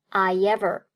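"I ever" joined by a y sound; linked with glide. Shown as a demonstration. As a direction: slip a y between "I ever". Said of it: "中间夹了烟那个音".

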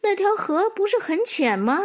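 high-pitched motherly question; mother horse. Acted. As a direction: question your foal, voice lifted. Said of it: "妈妈说". (马妈妈高声反问)

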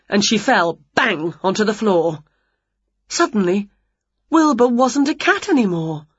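ordinary delivery

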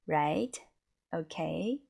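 "right" and "okay" each gliding upward; each word lifted at the end like a question; questioning rise. Shown as a demonstration. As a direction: ask "right?", then "okay?". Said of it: "the same print".

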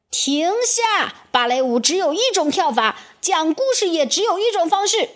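high, commanding outcry; shouting queen. A narrator's reading. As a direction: cry out in icy command. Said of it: "冰雪女皇叫起来".

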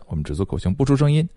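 normal talking voice

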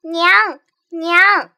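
loud desperate call for mother; shouting. Acted. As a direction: cry out loud in a dream. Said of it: "大喊".

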